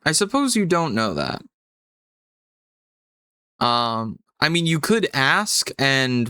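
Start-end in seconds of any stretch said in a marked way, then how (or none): none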